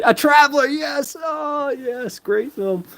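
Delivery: high, drawn-out cry of delight; real excitement. Real, not acted. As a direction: burst out loud, then settle as you savour it. Delighted at recalled film name.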